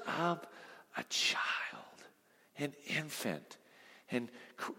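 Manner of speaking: fading to a near whisper; softly, as if not to wake the baby; tender whispered picture; hushed tenderness. A narrator's reading. Picturing Jesus holding an infant.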